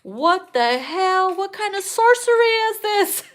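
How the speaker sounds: hammed-up disbelief; mock shocked man; mock amazement. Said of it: "they're like".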